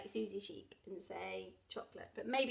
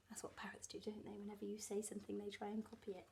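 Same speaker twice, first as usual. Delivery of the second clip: whispering; 4 semitones lower, 12 dB quieter, faster than usual. Real, not acted. Aside to child listener.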